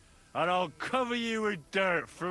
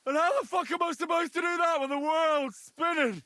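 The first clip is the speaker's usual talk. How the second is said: put-on panicked drunk shout; 8 semitones higher than usual. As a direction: yell it, dizzy and scared. Drunk, panicking, world spinning.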